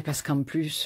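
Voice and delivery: normal talking voice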